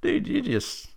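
high, wondering whoa; amazed. Real, not acted. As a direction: let the awe spill out.

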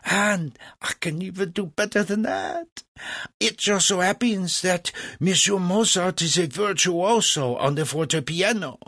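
high, boastful French impresario; gloating. A narrator's reading. Legras offers Mozart as virtuoso to Franklin, gloating. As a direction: crow over what you can offer. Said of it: "Legras gloated".

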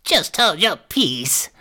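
high, slow crone scolding; old hag. A narrator's reading. Hag silences the lamenting Gretel, scolding crone voice. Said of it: "said the old hag".

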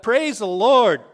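loud high exclamation of praise; a worshipper's sudden loud cry in church; retold shout. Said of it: "he just shouted out".

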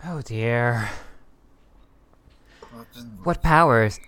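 ordinary talk, nothing marked

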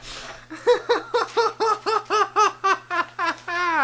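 high helpless laugh; cracking up, can't stop; real high laughter fit. Real, not acted. Cracking up at horse bit.

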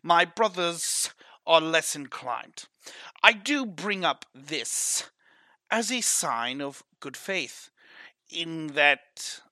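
[name]'s put-on smuggler accent, lightly held; a half-held put-on accent, smooth and forward; character accent. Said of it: "I lost my accent".

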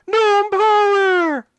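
high, drawn-out gnome shout; tiny gnome's rallying yell; gnome battle cry. Acted. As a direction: squeal it out loud and long. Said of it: "gives them slightly more masculine voice".

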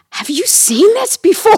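high, loud mock-alien astonishment; pretend alien. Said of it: "as if you're an alien".

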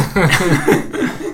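another high burst of laughter. Real, not acted. Laughing before resuming the ski station story.

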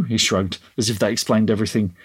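ordinary delivery

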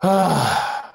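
long, weary stage sigh; heavy exhale before speaking. Shown as a demonstration. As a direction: let out a big demonstrative sigh. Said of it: "Just me doing that sigh".

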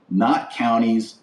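normal talking voice

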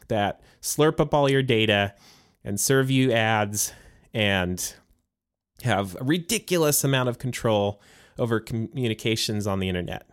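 usual speaking voice

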